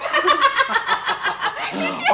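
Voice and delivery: high, drawn-out laughing fit; helpless giggle. Acted. Husband breaks up during fake phone call, giggling. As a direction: crack up mid-performance. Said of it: "ne me fais pas rigoler".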